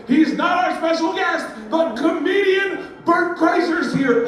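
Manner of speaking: high, loud emcee shout; hype announcer. Retold or imitated. Luau host announces comedian to the crowd, shouted emcee voice. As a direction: announce the name big, drawing it out.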